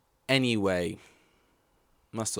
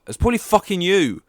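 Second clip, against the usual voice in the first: loud, high-pitched accusing outburst; angry retort; 10 semitones higher, 9 dB louder than usual. Retold or imitated. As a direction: throw the blame back loudly, as the retort you held in. Acts out unspoken angry retort.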